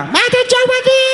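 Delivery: very high, slow stretched call; megaphone shout. Acted. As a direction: yell it down from a helicopter. Friend calls from the helicopter.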